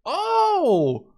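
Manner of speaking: a sudden loud high yelp of delight; thrilled cry; excited oh. Real, not acted. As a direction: burst out with real glee. Delighted by the unit find.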